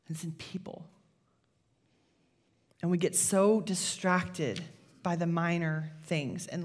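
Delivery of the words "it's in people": hushed whisper for the key point; a pastor whispering the lesson to the room. Acted. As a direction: breathe it out, unvoiced and close.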